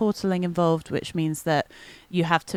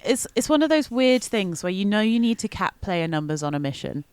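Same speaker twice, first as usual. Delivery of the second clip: ordinary talk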